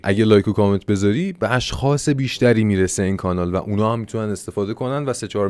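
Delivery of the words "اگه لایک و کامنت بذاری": normal talking voice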